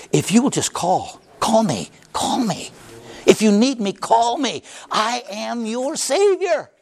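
high, tearful plea; a savior begging them to call; Jesus pleading. Acted. Jesus pleads with sinners to call him, tearful.

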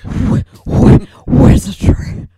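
loud, slow, hammed-up whispery wizard voice; hammed-up spooky stage whisper; mock wizardry. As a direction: blast it out in an unvoiced stage whisper, stretched.

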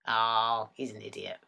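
very low, very soft dismissal; quiet, low-pitched verdict; dismissive aside. Acted. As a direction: drop right down and write him off under your breath. Voices her other, dismissive self.

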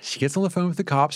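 ordinary talk

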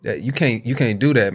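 ordinary delivery

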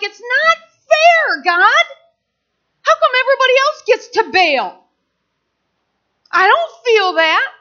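loud, high protest, pleading unfairness; yelling at God. Retold or imitated. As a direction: cry out an aggrieved complaint to heaven. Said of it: "I'm the one yelling".

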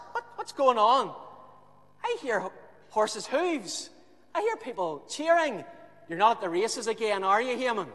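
high, loud worried wife; Haman's wife phoning him from home; wife on the phone. Acted. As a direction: call him, anxious, on the mobile. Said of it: "she dials his number".

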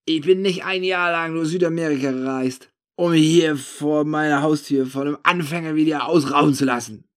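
put-on loud drunken tirade; slurred shouting. A narrator's reading. Drunk narrator berating an amateur robber, slurred shouting. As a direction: rant loudly with a drunk's slur. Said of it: "rufe ich ihm lallend ins Ohr".

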